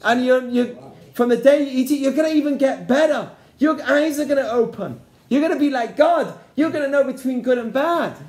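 the evil inclination selling godhood, mildly hammed-up; tempter's promise. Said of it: "It says".

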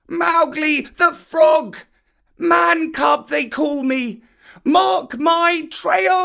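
high, slow shrieked call; shrieking. A narrator's reading. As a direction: shriek it while swinging. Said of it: "The last words were shrieked".

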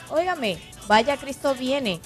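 ordinary delivery

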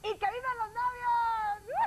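high, loud, drawn-out whoop; long jubilant cheer for the newlyweds; celebratory cheer. Real, not acted. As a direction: shout a wedding toast, stretching it out.

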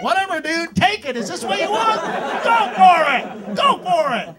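high, loud taunting of the mugger; mock defiant yelling. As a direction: dare him, shouting.

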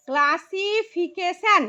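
very slow, ক্লা-সি-ফি-কে-শন in separate beats; syllable by syllable. Shown as a demonstration. Teacher showing five syllables in one word, syllable by syllable. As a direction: draw out each syllable of classification. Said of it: "এখানে পাঁচটা syllable আসছে".

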